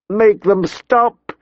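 high, slow, anguished cry; high distraught plea, drawn out. A narrator's reading. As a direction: beg through rising tears. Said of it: "she cried".

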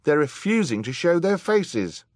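high, loud accusation; crying out. A narrator's reading. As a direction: call them out before everyone. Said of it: "cried somebody else".

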